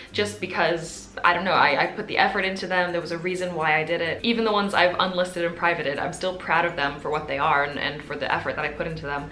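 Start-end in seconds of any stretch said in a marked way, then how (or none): none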